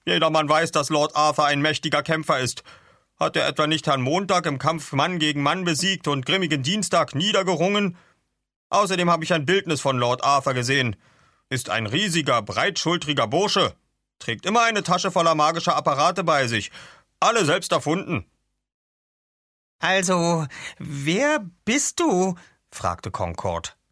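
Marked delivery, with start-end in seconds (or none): 0.1-18.2 higher, emphatic boastful recital; scoffing Katzenkissen. Acted. 19.8-22.3 high, slow, loud character voice; drawn-out questioning. Acted. Concord questions Arthur after the laughter.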